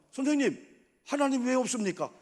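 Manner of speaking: high, forceful protest; loud challenge. Acted. Pupil challenging the teacher in history class, loud protest. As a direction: pipe up loudly, demanding an answer.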